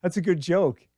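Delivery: high, loud fake-amused salesman; forced chuckle. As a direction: humour the customer's joke. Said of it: "He's like".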